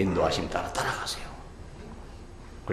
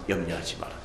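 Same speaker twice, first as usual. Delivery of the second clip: tender reassurance; 6 semitones lower, 8 dB quieter than usual. Acted. God's reassurance voiced softly.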